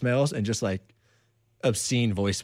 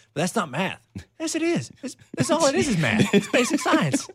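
high, laughing mock argument; quoting a film while cracking up; laughing movie quote; 3 dB louder than usual. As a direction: squeal it out through laughter.

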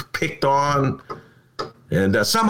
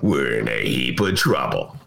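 Joe Higgins impression; 12 semitones lower than usual. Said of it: "to quote Joe Higgins".

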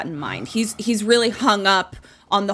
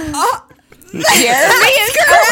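shocked announcement; 13 semitones higher, 10 dB louder than usual. Real, not acted. Shocked host announcing right answer.

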